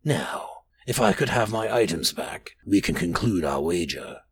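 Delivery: low, rasping sinister stranger. A narrator's reading. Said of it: "he rasped".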